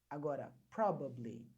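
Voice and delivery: probably said slowly, low; whole word after pieces. Shown as a demonstration. Teacher joins the pieces for students, slow and low. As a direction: put probably back together slowly. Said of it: "quebrar a palavra em pedaços".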